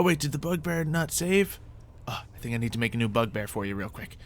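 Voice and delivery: usual speaking voice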